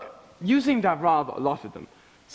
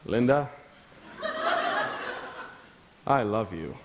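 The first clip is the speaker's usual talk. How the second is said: much lower, hammed-up romantic declaration through laughter; mock seductive lover; 6 semitones lower than usual. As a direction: play a swooning lover, half laughing. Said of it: "you stare her straight in the eye and you say".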